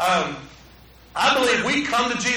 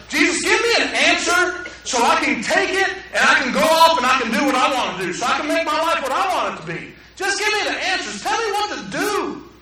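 higher, pushy plea for answers; demanding prayer; 6 semitones higher than usual. Retold or imitated. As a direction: pester God like an impatient child. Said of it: "We come to Jesus in the sense of".

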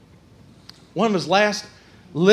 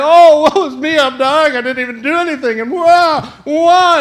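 mock self-pity; 4 semitones higher, 4 dB louder than usual. Mocking self-pity, whiny.

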